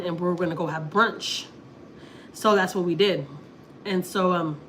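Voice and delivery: normal talking voice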